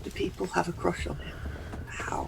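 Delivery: tiny incredulous voice, acted; hushed bafflement. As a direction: murmur it in baffled disbelief. Player voices hidden Flowers' puzzled reaction.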